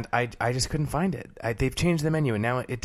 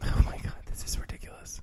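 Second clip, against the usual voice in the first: whispering; 5 dB quieter than usual. Real, not acted. Whispered argument aside.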